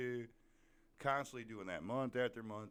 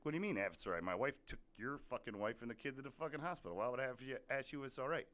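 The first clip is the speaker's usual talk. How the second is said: higher, indignant, faster retort; retold angry confrontation; 6 semitones higher, faster than usual. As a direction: throw his question back at him in disbelief.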